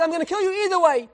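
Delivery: high, fast acted outburst; angry threat. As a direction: threaten as an exasperated emperor. Furious emperor threatens the teacher.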